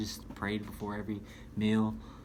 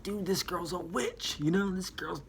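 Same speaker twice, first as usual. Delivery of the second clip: higher, slow amazed voice, as if discovering a real witch; telling a friend the book's author is a witch, amazed and pitched up; excited self-quote; 8 semitones higher, slower than usual. Retold or imitated. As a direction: play your younger self, wide-eyed and pitched up high. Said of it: "and I'm like".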